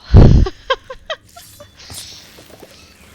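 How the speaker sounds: loud, very high-pitched laugh; long burst of high, helpless laughing; hard laughter. Real, not acted. Streamer tickled by Bizum Ball, high loud laughter. Amused by reading Scots game name.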